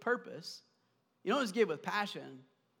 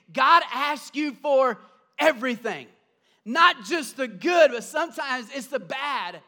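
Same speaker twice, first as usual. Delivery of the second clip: loud, higher preacher's climax; rising sermon fervor, punched words; full-force preaching; 5 semitones higher, 9 dB louder than usual. Real, not acted. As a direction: drive it home to the congregation.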